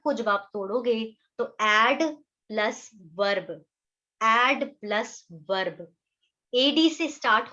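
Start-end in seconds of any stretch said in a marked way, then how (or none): 1.6-5.9 slow emphasis. Shown as a demonstration. Teacher repeating word breakdown.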